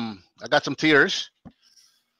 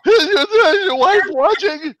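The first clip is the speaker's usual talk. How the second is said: voice cracking; 5 dB louder than usual. Real, not acted. Asking his co-host on camera, voice cracking high. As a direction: voice breaking up high into a squeak. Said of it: "Oh, my voice just broke".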